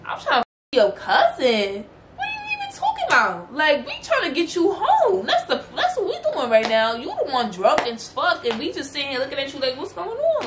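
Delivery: high, laughing disbelief; exasperated laughing comeback; incredulous retort. Retold or imitated. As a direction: scoff at the absurd accusation. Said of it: "So I'm like".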